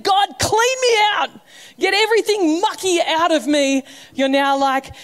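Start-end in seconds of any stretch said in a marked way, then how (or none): 0.0-3.8 earnest cry. Pretend. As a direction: cry out to God in full earnest. Imagined earnest prayer quoted.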